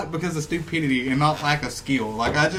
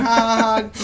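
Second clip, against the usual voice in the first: mock giggle, raised and loud; imitated giggle; 5 semitones higher, 4 dB louder than usual. Player shoots and runs from deer, imitated giggling. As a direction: laugh like you're toying with them. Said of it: "just kinda giggling".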